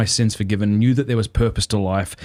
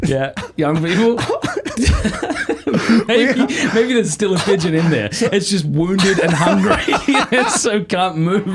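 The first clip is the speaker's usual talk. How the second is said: high giggly laughter through the words; can't stop laughing while talking; 8 semitones higher than usual. Real, not acted. Laughing fit over the pigeon.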